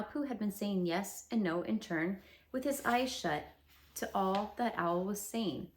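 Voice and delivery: usual speaking voice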